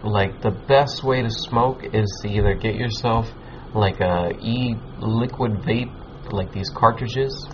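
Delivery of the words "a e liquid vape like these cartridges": ordinary talk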